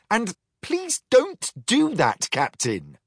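politely primly protest. Acted. Lexi tells the captain off primly. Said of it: "said Lexi primly".

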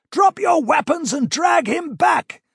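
loud high desperate command; shouting. A narrator's reading. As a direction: yell it across the battlefield. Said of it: "the prince shouted at Corin again".

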